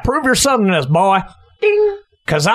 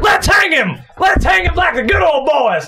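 mock mob yell; 8 semitones higher than usual. Man rallies the mob against the debater, yelling. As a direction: whip up the crowd.

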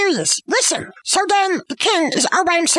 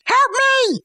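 raised-pitch retold call for help; mock cry for help; 7 semitones higher than usual. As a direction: call out as the alarmed queen, half in earnest. Queen cries out for help.

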